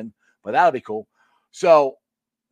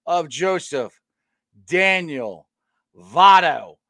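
slow, drawn-out announcer build; announcer intro; 3 semitones higher, 6 dB louder, much slower than usual. Acted. Host opening his show with a big announcer call.

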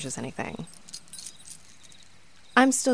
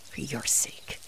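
whispered, softer menace; whispered threat; 4 semitones lower, 6 dB quieter, slower than usual. Acted. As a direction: breathe a quiet threat. Veiled threat.

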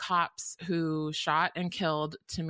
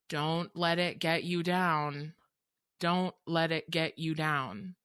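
slow, heavy, stern warning; hairdresser's slow, stern words of survival; stern advice; slower than usual. Retold or imitated. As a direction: say it slowly and seriously, a weary elder's counsel. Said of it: "he said it very sternly".

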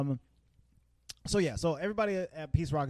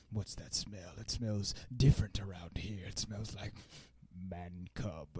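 low, soft, slow menace; Idris Elba; 7 semitones lower, 8 dB quieter, slower than usual. Retold or imitated. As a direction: sniff out an intruder, deep and deliberate. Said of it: "Idris Elba shows up. He walks in".

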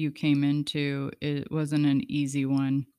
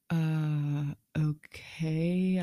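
unsure; 3 dB quieter, much slower than usual. Retold or imitated. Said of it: "I was really unsure".

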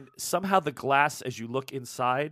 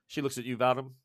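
ordinary delivery

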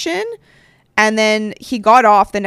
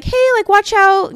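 put-on much higher, slow, sing-song warning to a child; sweet warning; 12 semitones higher, 7 dB louder than usual. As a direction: coo it gently at the kid. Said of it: "if I'm just like".

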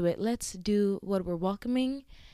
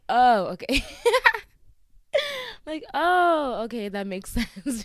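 louder, laughing through the words; laughing disbelief; 4 semitones higher, 8 dB louder than usual. Retold or imitated. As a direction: retell it while cracking up. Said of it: "And I'm like".

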